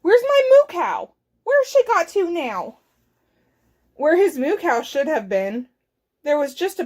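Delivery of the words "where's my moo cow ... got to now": high, loud cry of alarm; alarmed call. Acted. Farmer runs outside looking for missing cow, alarmed call.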